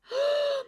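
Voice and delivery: high, sudden surprised gasp; a real gasp of shock; sharp gasp. Real, not acted. Finds unexpected product in box.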